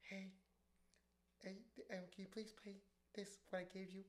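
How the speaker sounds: very soft, halting imitation of a host; timid polite request. Party host approaching the DJ with her list, meekly polite. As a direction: barely voice it, a meek little ask.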